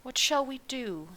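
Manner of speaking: a mild, worried plea; heartfelt cry. A narrator's reading. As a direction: voice lifting high in worry. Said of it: "a heartfelt cry of".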